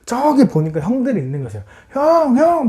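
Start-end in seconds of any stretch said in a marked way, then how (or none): 1.9-2.7 high, drawn-out call; acted eager shout to someone far away; excited calling out. Joseph running up to his brothers, eager high call. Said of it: "막 열심히 갔어요".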